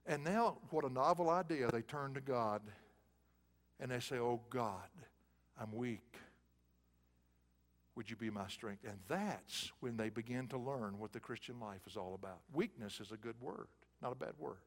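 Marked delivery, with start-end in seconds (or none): 4.2-8.8 soft, low, humble prayer; a hushed plea to God; weak prayer. Retold or imitated. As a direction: pray it softly as if spent. People praying in weakness.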